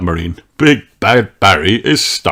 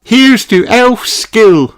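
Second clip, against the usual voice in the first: high, loud, slow toast; loud happy toast; toast cry; 6 dB louder, slower than usual. A narrator's reading. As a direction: raise a glass and cheer it. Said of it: "cries Princess Holly".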